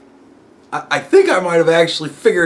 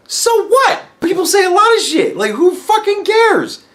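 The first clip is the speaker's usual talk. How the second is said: exasperated dismissal. Retold or imitated. Telling his friend nobody cares, exasperated high. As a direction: wave it all off, fed up.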